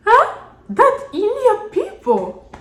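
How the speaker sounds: high, loud disbelieving huh; shocked huh. Real, not acted. To the viewers about the Indian friend, high surprised outburst. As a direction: react in loud astonishment.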